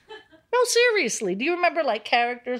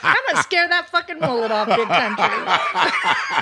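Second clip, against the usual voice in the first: higher, louder mocking heckle with laughter; taunting heckler voice; 6 semitones higher, 3 dB louder than usual. [name] taunting Big Country, a higher mocking heckle. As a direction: taunt him like a bully sizing up a scared guy.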